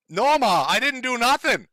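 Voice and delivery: high, loud, hammed-up protest; mock whining son. Host as the son denying it to Ma, mock indignant. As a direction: protest to Ma like a kid playing innocent.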